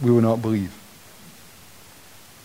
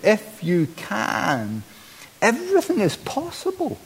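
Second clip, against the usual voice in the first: incredulous retort; 6 semitones higher, 7 dB quieter than usual. Acted. Preacher voicing Jesus' retort.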